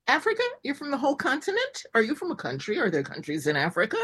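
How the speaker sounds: high, quick disbelieving questions; mock incredulous. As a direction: needle them with feigned shock. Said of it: "And I've said".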